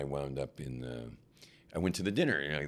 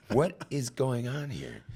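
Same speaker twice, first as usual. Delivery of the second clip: drawn out, higher and louder; slow bewildered; 8 semitones higher, 4 dB louder, slower than usual. Retold or imitated. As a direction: stretch it out in disbelief. Staring contest with dog.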